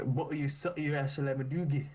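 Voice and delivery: lightly mocked Baltimore accent; Baltimore gibberish. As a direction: imitate garbled Baltimore street talk. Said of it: "it is the most gibberish ever".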